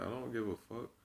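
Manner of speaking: ordinary talk, nothing marked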